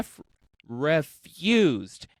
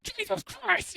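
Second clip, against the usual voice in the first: imitating shock; 5 dB quieter than usual. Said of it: "you're just like".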